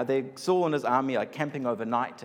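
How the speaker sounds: normal talking voice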